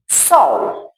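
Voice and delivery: "sol" as one short quick syllable; palavra rápida. Shown as a demonstration. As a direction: say "sol" briefly, a single falling beat. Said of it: "for bem rápida". ("sol" curto e rápido)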